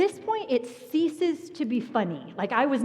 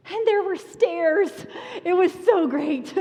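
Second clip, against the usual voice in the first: joyful relief; 9 semitones higher, 6 dB louder than usual. Real, not acted. Relief at finding stairs.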